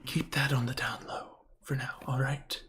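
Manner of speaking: hushed whisper, barely voiced. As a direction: whisper it so nobody overhears. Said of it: "Let's just whisper".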